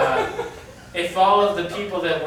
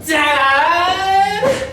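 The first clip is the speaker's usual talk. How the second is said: high, loud cry of protest; outraged shout; 10 semitones higher, 7 dB louder than usual. Acted. Daughter yelling at her father over the cancelled party. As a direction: yell it like a betrayed daughter.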